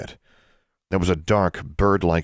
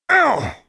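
sudden sharp yelp, cut off mid-threat; a short pained cry; 5 dB louder than usual. Acted. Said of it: "She yelped".

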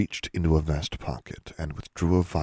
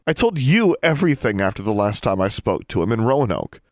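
loud, higher, indignant outburst; snapping; 6 semitones higher, 6 dB louder than usual. A narrator's reading. Alexandra reminding Miss Grimm what she told her, snapping. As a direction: throw it back at them angrily. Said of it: "Alexandra snapped".

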